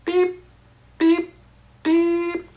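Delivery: high short beeps, one per press; keypad beeps. Retold or imitated. Imitating the keypad as he presses each button. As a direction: tap out each keypad beep.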